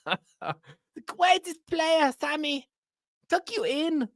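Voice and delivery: high-pitched cooing manager with a mock German accent; doting Daniel Farke impression. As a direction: coo over him like a doting dad. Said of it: "I enjoy doing his accent too much".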